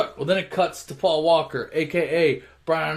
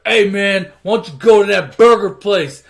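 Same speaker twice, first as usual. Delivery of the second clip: loud, raised-pitch aggressive challenge; confrontational; 5 semitones higher, 11 dB louder than usual. Retold or imitated. Vince picking on a customer at the diner, confrontational. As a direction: pick a fight, acting out the bully.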